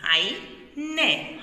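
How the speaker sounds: عين held very slowly; stretched kasra sound. Shown as a demonstration. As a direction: draw out عين for the kids. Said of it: "الكسرة". (مد كلمة عين ببطء شديد)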